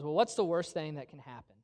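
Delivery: low, soft bedside question; quiet reassurance. Retold or imitated. Wife reassuring her frightened husband in bed, soft and low. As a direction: talk him down quietly.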